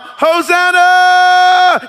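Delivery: slow, high, loud crowd cry; screaming out. Retold or imitated. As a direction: stretch it out like a shouting crowd. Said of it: "screaming out".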